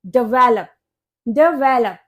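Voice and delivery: डेवलप as duh-VEL-up, twice; correct pronunciation. Shown as a demonstration. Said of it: "ये होता है". (डेवलप में बीच के 'वे' पर ज़ोर)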